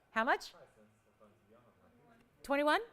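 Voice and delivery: ordinary talk, nothing marked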